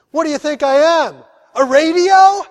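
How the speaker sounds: loud, high-pitched mock outburst; punchline shout. Pastor delivers penguin joke's punchline to Steve, loud mock outburst. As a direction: belt out the punchline, voice climbing.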